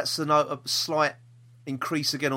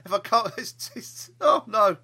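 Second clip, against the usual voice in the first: hammed-up crying, pitched higher, half laughing; mock tearful sob; 9 semitones higher than usual. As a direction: pretend to blub over the schedule, laughing through it. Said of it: "Strictly's got you all emotional".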